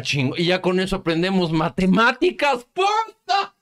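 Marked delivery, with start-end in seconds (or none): 1.5-3.5 rising to a very high-pitched exclamation; sarcastic high-pitched outburst; mock amazement. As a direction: burst out high in sarcastic wonder. Sarcastic cheer at senator's lesson.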